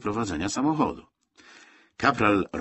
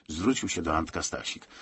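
plain reading voice

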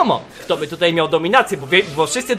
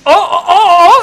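high, loud repeated "oh"; excited "oh" cries; 9 semitones higher, 8 dB louder than usual. Real, not acted. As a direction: burst out in rising excitement. Reacts to a hit.

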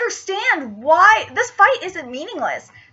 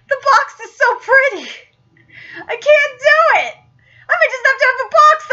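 mock anguish; 6 semitones higher, 3 dB louder than usual. Real, not acted. Anguish over unopened box.

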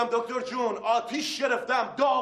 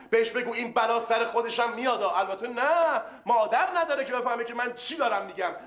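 ordinary talk, nothing marked

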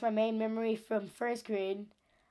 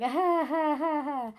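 mock laugh; 5 semitones higher than usual. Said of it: "she was like".

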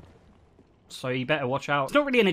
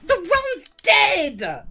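high-pitched wail of protest; whiny complaining; 8 semitones higher than usual. Real, not acted. Speedrunner whose run just died, whiny protest. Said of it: "I probably sound like such a whiny baby".

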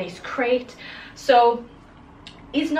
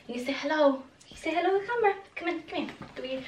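squeaky praising a dog; 5 semitones higher, 8 dB quieter, much faster than usual. Real, not acted. Coaxing puppy to greet camera.

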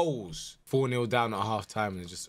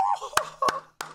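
a squeaky burst of laughter; delighted high laugh. Real, not acted. Amazed by a dribble.

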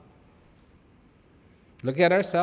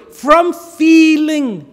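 louder, slow and drawn out; from feeling, proclaimed slowly and loud; emphatic; 9 semitones higher, 11 dB louder, much slower than usual. Shown as a demonstration. To the congregation, a slow loud proclamation. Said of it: "repeats phrase, loud then hushed".

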